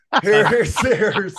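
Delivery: talking through laughter; laughing. Real, not acted. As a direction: laugh while cutting in. Co-host laughing, cutting in.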